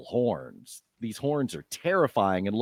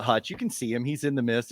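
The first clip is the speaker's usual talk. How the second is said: ordinary talk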